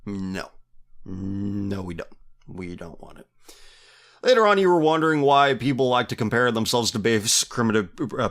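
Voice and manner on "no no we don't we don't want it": low, soft imitated refusal, fading; quiet refusal. Imitating China refusing such television depictions, quiet refusal. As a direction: voice their refusal quietly, dropping away at the end. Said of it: "they're saying, like".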